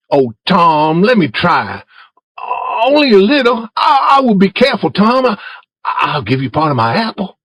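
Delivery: put-on high, eager boy's begging; Ben pleading.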